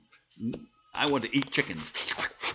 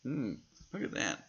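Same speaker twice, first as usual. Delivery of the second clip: plain reading voice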